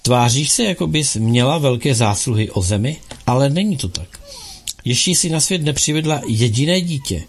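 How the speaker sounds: ordinary delivery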